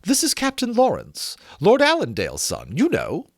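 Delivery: high, sweet society lady; a prim lady making introductions; Miss Montague voice. A narrator's reading.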